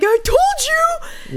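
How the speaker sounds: very high, drawn-out taunt; mock I-told-you-so. Imagined mom after the bad date, mock scolding triumph. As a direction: imitate a parent rubbing it in.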